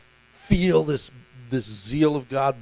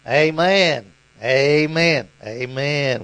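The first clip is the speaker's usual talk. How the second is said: put-on loud, drawn-out Southern amens in a Georgia accent; Pastor Mayo, Georgia accent; 5 dB louder, much slower than usual. Pastor cutting in on his rambling father, imitated Georgia accent. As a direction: imitate a Georgia preacher calling out amen. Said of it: "with that Georgia accent".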